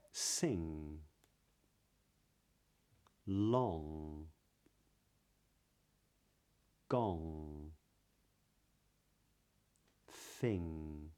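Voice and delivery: "Sing", "long", "gong", "thing" each ending on a clean ng, no g; slow, clear word-by-word drill ending on ng; modern british accent. Shown as a demonstration. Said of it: "So just copy me".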